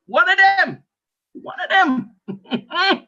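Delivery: high, incredulous cry; exasperated outburst. Acted. Comic dad complaining about one of his kids, high exasperated outburst.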